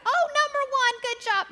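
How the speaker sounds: high bright loud praise; excited. Acted. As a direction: cheer them on quickly. Rushed cheerful praise.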